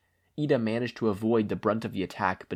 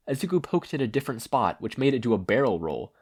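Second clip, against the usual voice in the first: ordinary delivery, nothing marked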